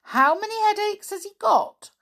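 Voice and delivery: amused, high-pitched query; grinning inquiry. A narrator's reading. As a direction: ask it with a big grin. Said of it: "inquired Sammy with a grin".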